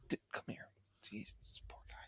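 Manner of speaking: whispered retold aside to a tiny creature; hushed coaxing. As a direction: murmur it very softly, almost voiceless. Gently rescuing the harmless spider.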